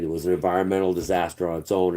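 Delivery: ordinary delivery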